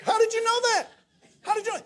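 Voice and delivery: very high-pitched incredulous cry; squealed mock amazement; astonished outcry. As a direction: demand an answer in comic disbelief. Acted amazement at dad's judgement.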